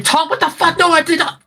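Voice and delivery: high, loud outburst of comic indignation; flustered screaming. Acted. Flustered Tanari mentally screams at Tom in denial, loud high shriek. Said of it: "and then mentally kinda screaming".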